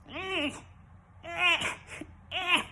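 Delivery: high whiny eh eh imitating a toddler; baby fussing noise. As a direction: mimic the baby's fussy protest. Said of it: "now he goes".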